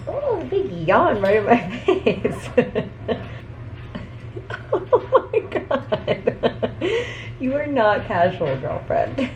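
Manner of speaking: laughing through the words; giggling at the cat's yawn. Real, not acted. Laughing at the cat.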